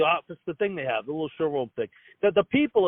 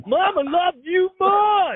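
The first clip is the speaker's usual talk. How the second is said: high, loud, slow mock mourning plea; mock tearful brother; 10 semitones higher, 7 dB louder, much slower than usual. Brother mockingly wailing to his dead mother at the funeral. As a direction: ham up a grieving son at the funeral.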